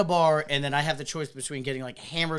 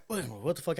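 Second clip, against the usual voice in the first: usual speaking voice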